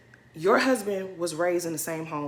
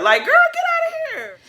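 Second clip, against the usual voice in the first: high dismissal; 6 dB louder than usual. Acted. Dismissing a castmate.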